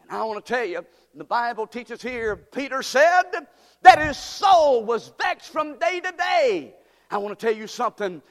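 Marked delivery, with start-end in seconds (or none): 2.4-6.7 higher, louder, slow; preaching at full force. Acted. Preacher warning his congregation, fervent. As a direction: drive the words home.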